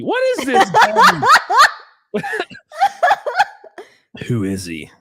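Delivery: high, loud squeal through laughter; laughing disbelief. Real, not acted. Host baffled by a stranger's profile, laughing. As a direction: crack up while dragging out the words.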